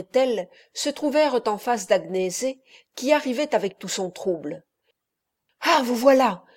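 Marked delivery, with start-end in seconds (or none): 5.6-6.4 louder, higher, strained; choked out. A narrator's reading. Agnese finds the couple, words struggling out. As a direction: force the words out of a tight throat. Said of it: "ayant peine à tirer les mots de son gosier".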